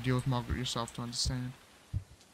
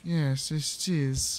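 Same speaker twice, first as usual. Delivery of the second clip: odd slow voice; much slower than usual. Pretend. Player announcing the ringing phone, slow and drawn out. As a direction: stretch every word out in a silly slow voice. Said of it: "I don't know why I'm talking like this".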